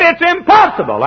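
high-pitched, emphatic protest; acted argumentative outburst; adamant objection. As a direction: insist it like an exasperated naysayer. Doubter insists in retold quarrel.

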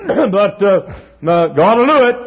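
high, loud preacher climb; preaching at full force. Real, not acted. Preacher lifting the congregation, rising and ringing. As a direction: build the sermon to a ringing peak.

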